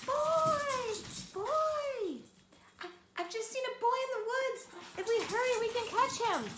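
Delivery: excited howling cry. Acted. Little wolf running home crying that he saw a boy. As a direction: shout it out as a false alarm. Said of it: "howling all the way".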